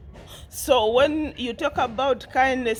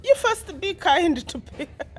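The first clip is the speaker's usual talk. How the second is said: laughing; 7 semitones higher than usual. Real, not acted. Laughing scold at Carol.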